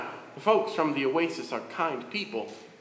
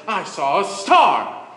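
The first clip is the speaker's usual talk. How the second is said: very slow, louder, full of wonder; awestruck reveal; 6 semitones higher, 8 dB louder, much slower than usual. A narrator's reading. Miriam finally revealing the star to the villagers, awestruck and slow. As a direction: savor each word like a great secret. Awed reveal after breathless buildup.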